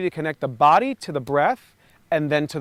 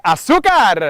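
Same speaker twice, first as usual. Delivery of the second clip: loud Spanish Azúcar, stress on zú; Azúcar shout; 3 semitones higher, 9 dB louder, slower than usual. Shown as a demonstration. Cuban coach showing the class her shout, loud Azúcar. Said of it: "because I'm Cuban, we say".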